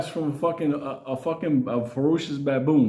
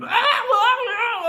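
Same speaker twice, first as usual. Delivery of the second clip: very high, drawn-out mock shrieks; imitated screaming; much slower than usual. Imitating two girls screaming in the back, high mock screams. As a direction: shriek it in falsetto like panicked passengers. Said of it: "screaming and something else screaming".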